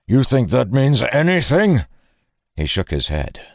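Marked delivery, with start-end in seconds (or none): 0.1-1.9 higher, louder indignant question; heated, incredulous challenge; angry retort. A narrator's reading. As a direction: throw it back scornfully, voice rising. Acted quarrel, bitter retort.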